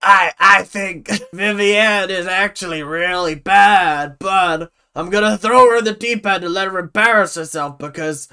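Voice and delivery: loud, put-on New York voice; mock New York accent. Imitating the dance teacher, mock New York accent. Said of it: "I don't know why she's from New York now".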